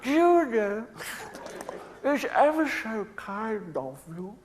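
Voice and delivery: high, slow, fragile voice; Elephant Man voice. Retold or imitated. Comic imitating the film character thanking the doctor, high and slow. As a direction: lift it high and draw it out shyly. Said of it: "he goes up to the doctor".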